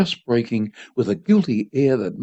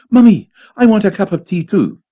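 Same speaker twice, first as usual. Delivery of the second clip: put-on loud, higher childish demand; whiny child; 7 semitones higher, 6 dB louder than usual. A narrator's reading. Boy demanding tea like father's, whining. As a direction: plead like a left-out little boy.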